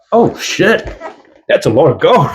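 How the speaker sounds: high, swooping mock astonishment; mock amazement. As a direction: play the whole world gawping in a high voice. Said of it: "everyone's gonna be like".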